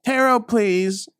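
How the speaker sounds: slow, loud, high announcement; dramatic call. Acted. Calling for the tarot, slow and loud. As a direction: declare it grandly.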